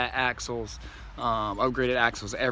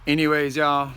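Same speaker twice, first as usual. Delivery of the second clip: loud upbeat sign-off; 3 semitones higher, 4 dB louder than usual. Real, not acted.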